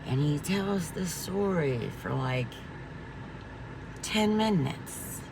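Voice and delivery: slow and quiet, like the doctor's endless stories; mock slow talker. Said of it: "he's talking so slow".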